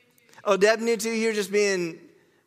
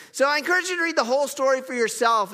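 fast, higher fight-night ring announcer call; Bruce Buffer; 7 semitones higher, faster than usual. Retold or imitated. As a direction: announce it like a UFC ring announcer hyping the main event. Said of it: "I heard Bruce Buffer".

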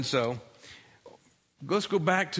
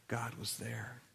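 much softer, hushed and low; quiet hush; 4 semitones lower, 12 dB quieter, slower than usual. A narrator's reading. Preacher reveals God in the still small voice, hushed. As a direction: let it fall to a hush. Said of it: "very quiet whisper".

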